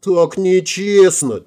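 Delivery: high, drawn-out whine; acted near-tearful protest; nearly crying. A narrator's reading. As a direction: whine it slowly, as if about to cry. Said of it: "чуть не заплакала она".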